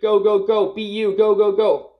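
high, loud commands barked over the noise; shouted urging. Real, not acted. Coach driving group to final scream, high loud shout. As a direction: push them to the peak, loud and high.

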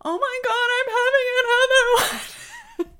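high pleading panic mid-labor; panicked cry. Retold or imitated. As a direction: relive the panic of another contraction. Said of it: "I remember saying to the nurse".

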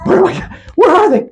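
rising mock-furious demand, pitch climbing; furious Laban. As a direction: play Laban tearing the tent apart in a huff. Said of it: "furiously going".

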